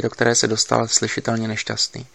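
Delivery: usual speaking voice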